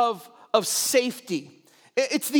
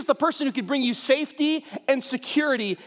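ordinary talk

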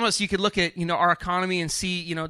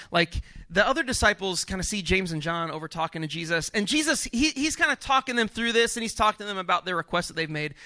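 ordinary talk, nothing marked

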